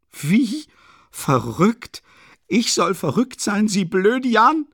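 high, loud, incredulous shriek; furious screaming. A narrator's reading. Katerina screaming back at being called mad, furious. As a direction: shriek the insult back, drawing out the last words. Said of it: "schrie Katerina Iwanowna".

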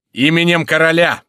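high, loud official summons; thunderous shout. A narrator's reading. Crillon demanding entry for the king, thunderous shout. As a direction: thunder it out to the building. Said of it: "кричал Крильон громовым голосом".

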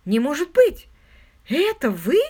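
loud, high disbelief; astonished cry. A narrator's reading. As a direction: exclaim as if you can't believe who it is. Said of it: "вскричала она".

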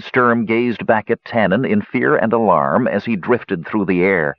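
plain reading voice

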